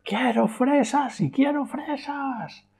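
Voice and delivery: put-on very high, slow whining child; child's tantrum. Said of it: "no renuncia a su satisfacción".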